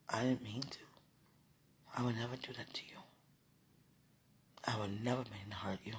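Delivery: tender apologetic whisper. Real, not acted. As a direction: hush it, sincere and soft.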